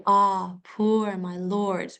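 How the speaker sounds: "Ah, poor my lord" slowly, with a strong AH; drawn-out vowels. Shown as a demonstration. Teacher showing the sounding of the ah, drawn-out vowels. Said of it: "the sounding of the ah".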